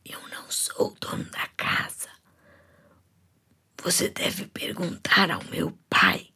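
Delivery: sussurro quase sem voz; sussurrado, fraco e rouco; fiapo de voz. Acted. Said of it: "com um fiapo de voz". (um fio de voz sussurrado)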